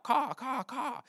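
imitated crow cry, high-pitched; high, repeated crow call; crow cawing. Shown as a demonstration. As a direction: caw like a crow crying out. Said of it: "that crows sound whenever they're, uh, crying out".

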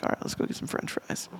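hushed, half to yourself; a dad's quiet whisper to his son in the car; whispered aside. Retold or imitated. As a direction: whisper it under your breath. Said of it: "I'm like".

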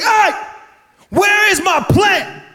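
high, slow shouted mother's demand; mom yelling. Retold or imitated. Mom discovers her plant is gone, high shout. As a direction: belt it out as the angry mom.

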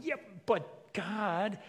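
high, drawn-out mock pleading; imitating a whiny excuse-maker; whiny protest. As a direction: drag it out like a reluctant child objecting. Acted reluctant protest to God.

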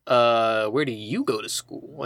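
a hammed-up too-cool teen; mock cool guy. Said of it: "And he's like".

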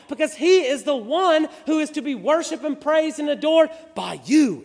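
preacher rising to a full-force climax; impassioned preaching. Acted. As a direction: hammer each phrase to the congregation.

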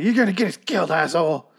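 high-pitched imitation of an angry telling-off; mock angry yell. Woman chewing out Rick over the noise, high mock yell. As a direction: chew him out in a put-on high voice. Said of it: "Andrea's really yelling at him".

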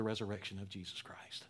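hushed whisper, drawing the listener close; whispered appeal. Real, not acted. Pastor urging the listener to be made alive, whispering. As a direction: breathe it like a private plea.

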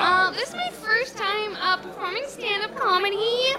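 put-on very high-pitched girly stand-up voice; first-time comic in a high, put-on voice; gushing amateur comedian.

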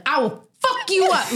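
high, furious road-rage threat; crazy rage yell. Retold or imitated. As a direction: scream it at the guy in the next car. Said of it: "like, crazy".

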